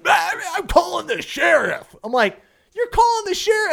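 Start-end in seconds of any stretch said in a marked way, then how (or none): 0.1-2.0 high, slow, roaring mock threat; raving mad. Furious boater threatening after the anchor drop, raving mad. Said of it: "stark raving lunatic mad". 2.8-3.7 very high, loud outraged question; incredulous yell. Retold or imitated. Guide answering the raging boater, incredulous yell. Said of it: "I'm yelling at the guy".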